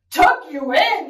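loud incredulous question; bellowing. Acted. Pirate captain answers child's bedtime request, bellowing. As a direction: bellow it back in disbelief. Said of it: "he bellowed".

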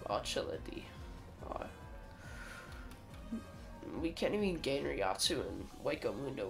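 ordinary talk, nothing marked

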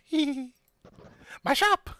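high giggling shopkeeper; tiny excited voice. Acted. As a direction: squeal about the shop proudly. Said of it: "clearly tiny and excited".

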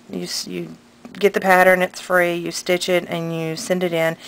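usual speaking voice

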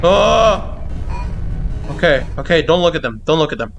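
ordinary talk

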